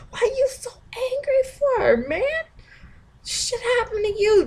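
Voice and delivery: very high mock disbelief; an incredulous reader, lightly voiced. Imagined reader reacting to angry poster.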